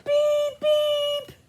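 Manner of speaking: high robot beep; squeaky robot beeping. Acted. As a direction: chirp it like a little robot.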